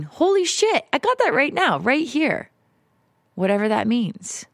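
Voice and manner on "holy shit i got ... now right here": amazed realization. Retold or imitated. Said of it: "and realizing".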